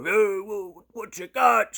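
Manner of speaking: high, loud mock hollering; imitated screaming. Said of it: "screaming all this stuff".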